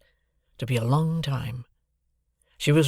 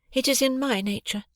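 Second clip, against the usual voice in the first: put-on cool, much higher young woman; calm and unmoved; Estella's voice; 12 semitones higher than usual. A narrator's reading. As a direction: Estella insisting on her coldness.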